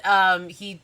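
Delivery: usual speaking voice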